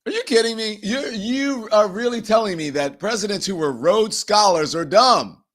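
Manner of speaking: ordinary talk, nothing marked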